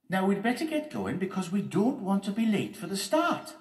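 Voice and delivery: high, loud character voice; Loretta, urging. Acted. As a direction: chivvy everyone along. Voicing Loretta.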